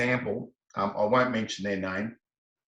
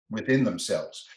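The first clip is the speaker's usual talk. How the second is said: ordinary talk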